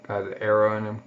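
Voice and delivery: usual speaking voice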